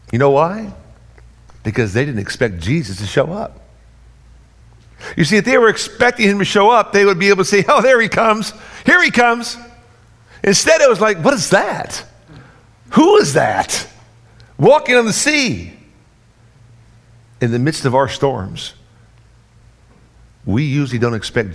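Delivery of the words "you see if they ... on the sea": high, loud sermon delivery; preacher acting out the disciples' alarm; full-force preaching. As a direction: preach it out with fire.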